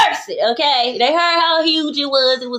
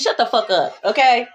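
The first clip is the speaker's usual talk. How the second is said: normal talking voice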